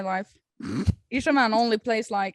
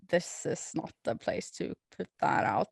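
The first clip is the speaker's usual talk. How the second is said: hushed, voiceless scolding aside; a commenter's scolding, read as a whisper; whispered mock scold; 5 semitones lower, 6 dB quieter than usual. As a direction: whisper it like a prim rebuke.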